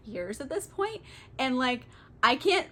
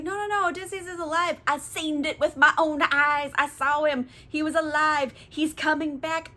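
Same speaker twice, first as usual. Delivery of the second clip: acted urgent insistence; 5 semitones higher than usual. Acts out Odysseus insisting he's alive.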